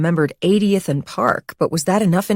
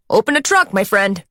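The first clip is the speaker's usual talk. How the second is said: put-on higher, jolly New York doorman accent; 4 semitones higher than usual. Doorman telling the cab driver to open the trunk, New York accent. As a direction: call out to the cabbie, New York style. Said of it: "in a nasal New York accent".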